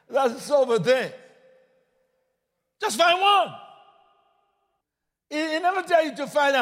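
fiery preacher building to a shout; preaching at full force. Acted. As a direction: drive it home to the congregation.

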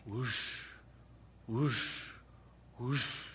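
quiet, low breathy whoosh noises; faint imitated suction whoosh; soft whoosh sound. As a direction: mimic a distant vacuum softly. Imitating vacuum sound softly.

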